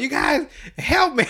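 put-on high, loud panicked kid cry; Cartman yelling. Cartman begging his friends for help, high panic. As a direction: squeal for help as the cartoon kid.

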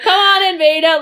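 high, loud cheery doctor voice; mock welcoming doctor. Mocking the bored doctor greeting Veda, cheery and high. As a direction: greet her like a bored, too-eager doctor. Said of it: "he's like".

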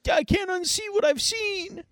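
high cracking Morty whimper; Morty impression. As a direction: whine it like a rattled Morty. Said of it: "Morty's like".

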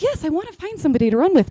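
quick, high and eager; super excited. Retold or imitated. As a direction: gush with excitement. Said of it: "I was like".

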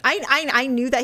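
ordinary delivery

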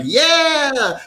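high, drawn-out mock cheer; group cheer. Friends all agreeing to the bungee jump, cheering. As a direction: imitate tipsy friends whooping along.

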